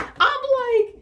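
high, loud stretched like; high loud squealing like; excited squeal. Real, not acted. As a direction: squeal the word. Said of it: "I got so excited".

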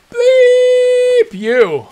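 very high, loud, drawn-out yell; defiant shout. Pretend. Streamer yelling his defiance at the game's developers, very high and loud. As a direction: scream it in their faces.

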